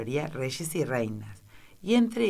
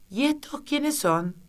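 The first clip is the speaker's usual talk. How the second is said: queen; 12 semitones higher than usual. A narrator's reading. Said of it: "dijo la reina".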